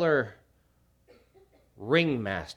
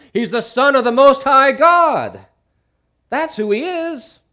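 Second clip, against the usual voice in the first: high, loud, slow announcement; the demons declaring who Jesus is; demonic proclamation; 13 semitones higher, 14 dB louder than usual. Acted. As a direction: proclaim it at full volume. Demons answering the disciples.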